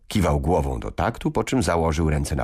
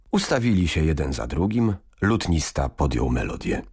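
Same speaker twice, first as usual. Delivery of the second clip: ordinary delivery, nothing marked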